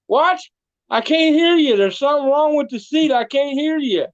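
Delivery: preacher mocking Goat, pretending not to hear; mock playing deaf. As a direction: throw the trick right back at him. Preacher turns Goat's trick back.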